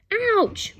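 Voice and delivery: high, loud sharp "Ouch"; pained yelp. Acted. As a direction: cry out in pain. Boy whacked again.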